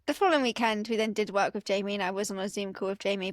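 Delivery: usual speaking voice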